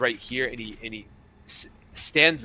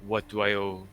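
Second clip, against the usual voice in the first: measured captain voice, slightly lowered; retired pirate captain; 3 semitones lower than usual. Acted.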